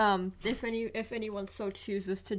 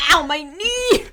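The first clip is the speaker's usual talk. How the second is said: loud high yelp of pain; sudden pain; 6 semitones higher, 14 dB louder, slower than usual. Real, not acted. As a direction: cry out from a knee bang. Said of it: "I hit ... my knee on the corner of my desk".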